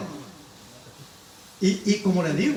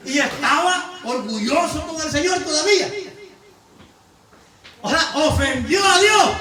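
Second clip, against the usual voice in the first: loud, high, rousing sermon voice; full-force preacher calling the flock to God; fiery preaching; 11 semitones higher, 9 dB louder than usual. Acted. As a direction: preach it out to the whole church.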